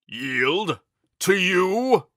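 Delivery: outraged incredulous knight; offended. A narrator's reading. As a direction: scoff at the very idea. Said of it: "exclaimed as if truly offended".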